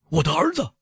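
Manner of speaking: high-pitched cry of alarm; sudden cry. A narrator's reading. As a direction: cry out suddenly for your child. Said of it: "他突然嚷道".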